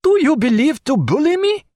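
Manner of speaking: put-on high, loud, furious French accent; furious French cry. A narrator's reading. Pontécanet rages at Jack over the chessboard. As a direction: explode in French-accented rage. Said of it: "cried Pontécanet".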